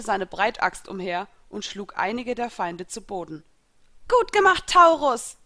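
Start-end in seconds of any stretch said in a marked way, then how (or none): 4.1-5.4 high, loud praise; spirited shout, starting very high; motivated cheer. A narrator's reading. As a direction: cheer your partner on. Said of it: "rief ich motiviert".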